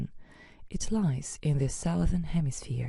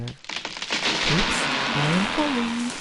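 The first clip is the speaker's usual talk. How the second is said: falling cry; 6 semitones higher, 4 dB louder, much slower than usual. Acted. Kangaroo character falls over.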